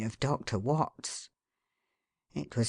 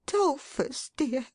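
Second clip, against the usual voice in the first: tearful wife; 11 semitones higher, slower than usual. A narrator's reading.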